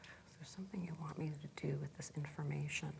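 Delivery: hushed whisper, asking the voice inside; murmured under the breath to oneself. Real, not acted. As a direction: whisper it inwardly, barely audible. Client puts coach's question to inner voice.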